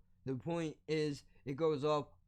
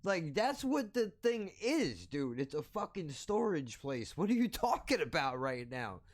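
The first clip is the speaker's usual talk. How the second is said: imitating an irked friend, pitch jumping high on the question; aggravated disbelief; 4 semitones higher than usual. Friend correcting him about the storage place, aggravated. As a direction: act out exasperation, starting high and easing down. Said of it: "kinda aggravated".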